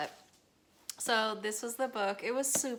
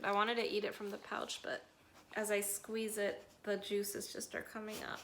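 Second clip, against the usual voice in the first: normal talking voice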